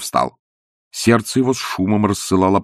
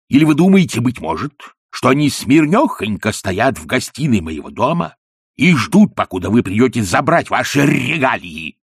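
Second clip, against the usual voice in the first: louder, higher, mocking fury; sarcastic angry rant; 5 semitones higher, 4 dB louder than usual. A narrator's reading. Ippolit mocking the dying woman, angry and loud. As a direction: heap scorn on her, loudly. Ippolit rants at mother-in-law.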